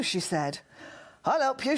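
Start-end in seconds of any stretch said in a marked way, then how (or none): 1.2-1.8 eager raised-pitch offer; half-hearted shout, pitched up; mild shout. A narrator's reading. Band member offers help at the meeting, shouting mildly. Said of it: "shouted Mister Boy Band member".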